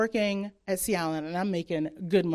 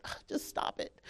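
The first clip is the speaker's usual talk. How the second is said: whispered brush-off; 13 dB quieter than usual. Retold or imitated. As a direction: whisper it, fed up. Brushing off mom's preaching.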